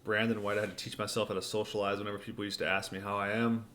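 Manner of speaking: ordinary talk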